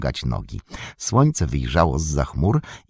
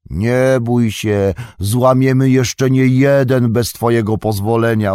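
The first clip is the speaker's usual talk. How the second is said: cheeky retort; 5 semitones higher, 5 dB louder than usual. A narrator's reading. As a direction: toss it back loudly as you leave. Pippin calls back a cheeky retort.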